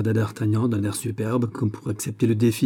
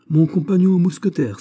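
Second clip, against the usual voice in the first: louder, higher, heartfelt vouching; with warm fervour; 8 semitones higher, 6 dB louder than usual. A narrator's reading. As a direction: speak up ardently for a comrade. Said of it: "dit avec chaleur D'Artagnan". (avec chaleur et ferveur)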